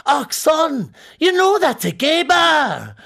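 put-on loud high Scottish accent, slowing down; Scottish taxi driver. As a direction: boom it out like a Glasgow cabbie. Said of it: "the taxi driver said".

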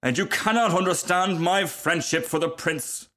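high-pitched, huffy self-importance; offended rocket. Acted. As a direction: protest as one gravely misunderstood. Rocket insulted.

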